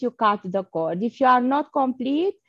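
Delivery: normal talking voice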